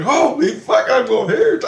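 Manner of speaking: high, loud mock yell; retold freak-out. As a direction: imitate someone shocked to be suddenly moved. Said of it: "And he's freaking out".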